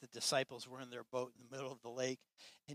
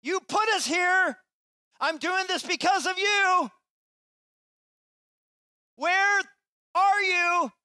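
shouted much higher and louder, slow; desperate shout to God; 15 dB louder, slower than usual. Acted. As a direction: yell at heaven in anguish. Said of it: "We're like".

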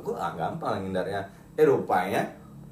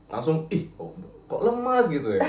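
laughing; 4 semitones higher, slower than usual. Real, not acted. Laughing at feeling weak.

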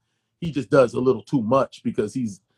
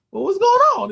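pitched way up, probing; high questioning; 5 dB louder than usual. Retold or imitated. Pressing producer on phone, pitched way up. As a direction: ask it high and pointed. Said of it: "I'm like".

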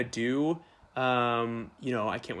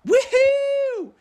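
excited cheer; 6 dB louder than usual. Retold or imitated. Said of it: "coming in like".

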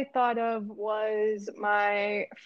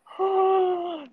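shocked gasp; 9 semitones higher than usual. Real, not acted. Listener hearing the story's twist, shocked gasp. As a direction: suck in air in amazement.

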